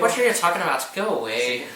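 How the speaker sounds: high, loud put-on Irish accent; mock Irish accent. Shown as a demonstration. Performing for viewers in Ireland in a mock Irish accent. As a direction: ham up a fake Irish accent. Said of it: "Do an Irish accent for our viewers".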